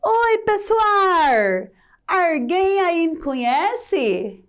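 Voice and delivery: put-on high, slow rural caipira accent, R for L; Chico Bento caipira voice. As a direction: play the country boy Chico Bento, drawn out. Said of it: "O Chico Bento está falando com vocês".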